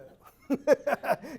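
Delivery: high, loud burst of laughter. Real, not acted. Speaker recalling his karate rivalry, laughing out loud. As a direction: laugh hard at the memory.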